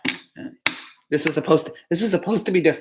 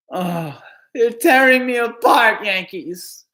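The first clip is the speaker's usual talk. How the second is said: slow, higher, theatrical anguish at the team; melodramatic lament; 6 semitones higher, much slower than usual. Real, not acted. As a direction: wail it out like a wounded fan. Fan laments team's playoff loss.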